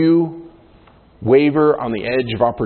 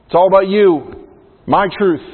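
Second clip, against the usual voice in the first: higher, louder, smug self-help voice; mock trendy voice; mocking the culture; 3 semitones higher, 4 dB louder than usual. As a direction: lampoon the you-do-you slogan. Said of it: "our culture is saying".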